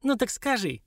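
high-pitched taunting challenge; sarcastic. A narrator's reading. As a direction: dare her, mockingly. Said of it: "язвительно засмеялась девушка".

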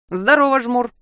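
rough hearty shout; loud greeting. A narrator's reading. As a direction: bellow a greeting. Rafik greets loudly.

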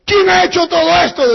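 high, loud accusing questions; father shouting accusations; angry questioning. Acted. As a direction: demand who did it. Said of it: "decía el hombre".

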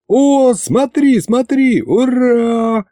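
high, stretched cheer; excited yell. A narrator's reading. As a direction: yell out in sudden jubilation. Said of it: "завопил я".

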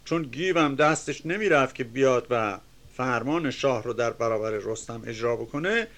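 ordinary delivery

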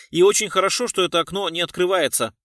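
loud, high insistence; defiant objection. A narrator's reading. Passenger is glad the window is jammed, defiant. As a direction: insist testily, brooking no argument.

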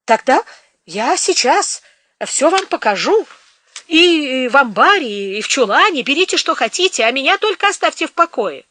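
high, loud anxious begging; offering everything, scared; pleading. Acted. Frightened peasant pleading.

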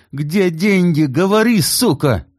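high loud furious demand; furious yell. A narrator's reading. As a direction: shout at her in rage. Kit attacking wife again. (яростный крик)

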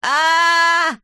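long high scream "aaah"; drawn-out horrified shout; terrible yell. A narrator's reading. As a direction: cry out in a loud, sustained high voice. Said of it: "страшным голосом закричал Незнайка".

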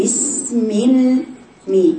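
imitating a faulty, drawn-out بسم with "mi" held; slow, dragged-out "bismi-il"; overstretched vowel error. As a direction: mimic a student over-lengthening the end of بسم. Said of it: "ولا ترجعي تقولي".

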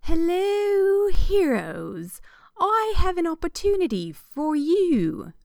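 high, slow, hammed-up British character voice; put-on British accent. A narrator's reading. Hooded rich figure offers heroes a quest, hammed-up British accent. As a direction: greet them grandly in exaggerated British. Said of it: "She got to use her character voice".

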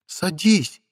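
high, short imperious order; commanding witch. Acted. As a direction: order him like a spoiled queen. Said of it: "велела Воронецкая". (властный приказ ведьмы)

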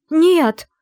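high, loud cry of no; almost yell the refusal; near shout. Acted. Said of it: "почти выкрикнула я". (почти выкрик, высоко и громко)

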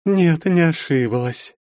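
high, slow sultry voice. A narrator's reading. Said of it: "ещё более томным голосом".

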